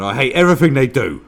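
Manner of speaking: loud, high imitated rant; mock rant. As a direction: yell it as a hammed-up bigot. Quotes the more consistent racist.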